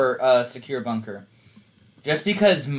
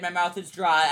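ordinary talk

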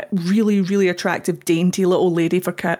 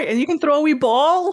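excited pet talk; 8 semitones higher than usual. Retold or imitated.

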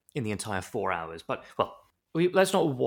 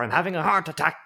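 high, loud choking rat voice; dying rat; 6 semitones higher, 7 dB louder than usual. Pretend. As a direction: gasp it like a rodent dying on stage.

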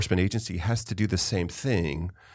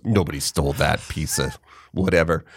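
low, dismissive New York guard voice; lightly voiced New York security guard brushing it off; 6 semitones lower than usual. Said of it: "from New York".

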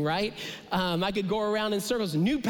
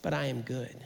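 low, soft reassurance; quietly comforting divine reply; gentle God voice; 6 semitones lower, 5 dB quieter than usual. Pretend. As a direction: drop low and tender as God answering. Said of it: "And God goes".

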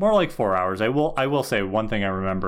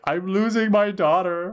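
higher, drawn-out mock lament; slow, pitched-up imitation of a dismayed dad; distraught father; 8 semitones higher than usual. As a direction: wail it lightly like a dad losing his girl. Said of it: "Charlie's like".

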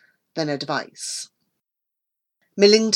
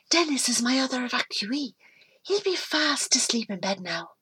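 gentle; 5 semitones higher, 8 dB quieter than usual. Acted. Amy speaks gently to the boy.

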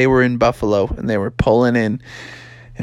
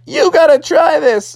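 high, eager frog dad; animated, pitched-up coaxing; excited urging; 14 semitones higher, 4 dB louder than usual. Acted. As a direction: egg your son on from below. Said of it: "And he's like".

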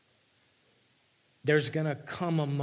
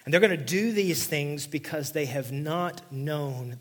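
loud, slow, ringing proclamation; preacher thundering the verse; forceful scripture reading; 4 semitones higher, 9 dB louder than usual. Acted. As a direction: read it out like a prophecy.

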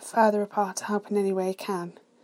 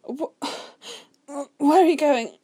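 high, stammering moan; moaned question; 7 semitones higher, 8 dB louder, much slower than usual. A narrator's reading. Man dismayed as his brother leaves, moaning. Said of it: "he moaned".

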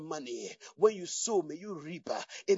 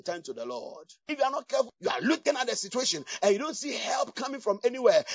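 ordinary talk, nothing marked

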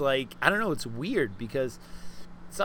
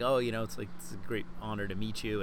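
ordinary talk, nothing marked

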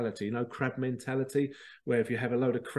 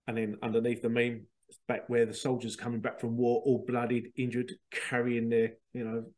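ordinary talk

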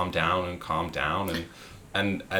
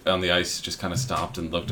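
ordinary delivery

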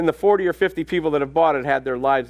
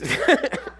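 laugh; 8 semitones higher than usual. Real, not acted. Laughs at own story.

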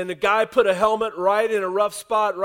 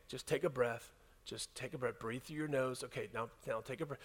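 much softer, lower, reassuring coach; soft coaching calm; 8 semitones lower, 16 dB quieter than usual. Retold or imitated. Coaches to a winded player on the ground, soft and calming. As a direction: talk him gently through it, low and quiet.

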